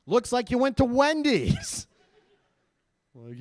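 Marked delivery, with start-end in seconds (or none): 0.1-1.9 higher, louder, slower, rising at the end; incredulous bank agent. Acted. Bank agent reads out a second Wendy's charge, mock incredulous. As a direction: stretch it out in mounting mock disbelief.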